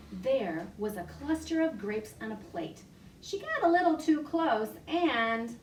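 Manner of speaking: animated library storytime voice; reading to children. Acted. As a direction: tell it like a picture book for toddlers. Reads flannel story to children.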